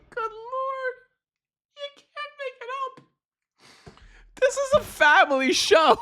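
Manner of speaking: very high, squeaky, laughing through the words; losing it completely; real uncontrollable giggles; hysterical laughter. Real, not acted. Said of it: "I'm laughing so hard".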